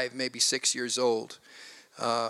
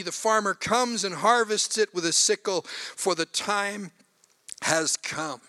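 scripture reading; 4 semitones higher than usual. Acted. Pastor reading Mark chapter four to the congregation, solemn. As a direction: read the parable with weight. Reads scripture passage aloud.

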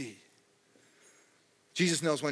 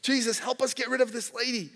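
higher, complaining whine; whiny disciples; 8 semitones higher than usual. Retold or imitated. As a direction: act out the fed-up disciples. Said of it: "So the disciples are like".

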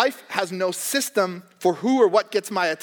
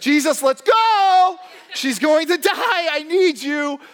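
high, loud, slow plea to hurry; anxious father; 9 semitones higher, 5 dB louder, slower than usual. Retold or imitated. Dad urging Jesus to his dying daughter, high slow pleading shout. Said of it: "He's like".

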